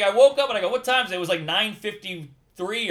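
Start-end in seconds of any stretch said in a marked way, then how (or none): 0.0-1.2 high, loud, alarmed storytelling; panicked retelling. Retold or imitated. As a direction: act out waking up late in a panic. Reenacting waking late in panic.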